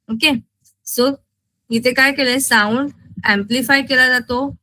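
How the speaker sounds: usual speaking voice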